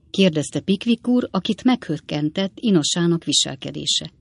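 ordinary delivery, nothing marked

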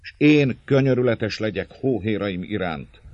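felindult, magasabb és hangosabb szónoklat; növekvő indulattal vágd oda; szenvedélyes düh. A narrator's reading. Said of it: "dühösségig növekedő szenvedéllyel". (dühös szenvedéllyel: dühödt szenvedéllyel)

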